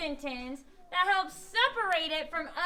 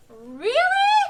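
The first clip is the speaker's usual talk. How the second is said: "Really" loud, high, drawn upward; exclamation; 6 semitones higher, 10 dB louder than usual. Shown as a demonstration. Teacher contrasts possible meanings, loud exclaiming. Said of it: "we would have no idea if it meant".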